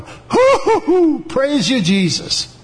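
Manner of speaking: high-pitched whoops settling into praise; retold joyful hooting, falling back toward normal pitch; jubilant whooping. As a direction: imitate a new convert bursting out of the water, elated. Acts out baptized biker coming up whooping.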